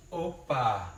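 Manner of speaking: ordinary talk, nothing marked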